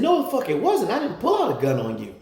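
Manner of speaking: high, loud furious denial; heated denial to the wife; angry denial. Retold or imitated. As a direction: shout her down. Denying the gun accusation.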